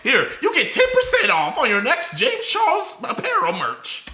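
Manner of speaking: high mock pitch-man voice; lightly voiced cheesy merch plug; a hint of a sales pitch; mock salesy James. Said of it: "He would just give you a voucher card".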